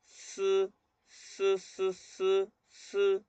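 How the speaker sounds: "ts" blown out as a long S hiss; blown S sound. Shown as a demonstration. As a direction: blow air steadily through each "ts". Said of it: "a soplar".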